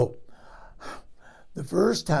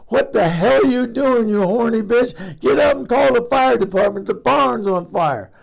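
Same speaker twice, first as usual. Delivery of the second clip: furious husband yelling, high and loud; retold yell; 8 semitones higher, 8 dB louder than usual. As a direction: bellow it like a shocked, angry husband. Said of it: "this is what he said".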